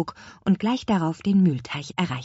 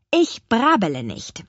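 higher, louder correcting retort; indignant rebuke; 6 semitones higher, 6 dB louder than usual. A narrator's reading. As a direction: set him straight sharply. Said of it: "wies Petronella ihn zurecht". (empörte Zurechtweisung)

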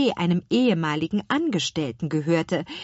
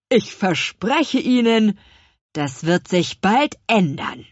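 louder, slow, gloating villain promise; smug slow threat; 3 semitones higher, 7 dB louder than usual. Acted. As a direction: lean on each word. Baker threatens the family.

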